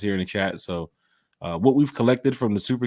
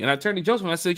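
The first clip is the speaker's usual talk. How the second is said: ordinary talk, nothing marked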